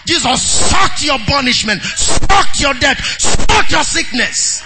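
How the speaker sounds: drawn-out, explosive "sucked" each time; building preacher's cry; forceful preaching. Real, not acted. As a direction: hammer each phrase home to the congregation.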